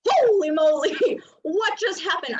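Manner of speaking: loud, slow mock shock at the outburst; startled exclaim. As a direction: gasp it out like you've been ambushed. Startled partner reacts.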